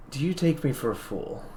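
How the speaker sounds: soft, calm and gentle challenge; softly spoken, unruffled rebuke; calmest, gentlest voice. Acted. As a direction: ask it very gently, with quiet composure. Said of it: "the calmest, probably gentlest voice ever".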